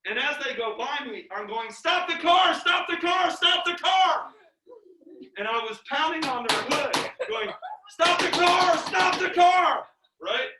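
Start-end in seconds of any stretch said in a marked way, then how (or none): 1.7-4.3 high, loud repeated shouts; frantic shouting. Retold or imitated. Retelling himself yelling as the car goes by, frantic shouting. 7.9-9.9 loud, high yells, last word drawn out; frantic shouting. Retold or imitated.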